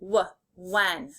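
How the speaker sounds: "W" as a "wuh" sound, then "when" whole; sounded out, blended. Shown as a demonstration. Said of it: "makes a W sound".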